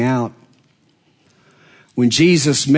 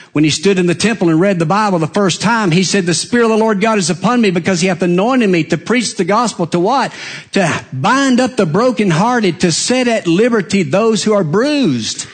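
impassioned preacher building up; rising sermon preaching; 6 semitones higher than usual. Acted. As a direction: preach it higher and faster, building.

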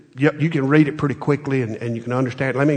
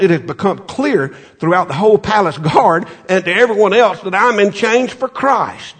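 higher, louder preached reading; fervent scripture reading; 7 semitones higher, 4 dB louder than usual. Acted. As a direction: proclaim the verse.

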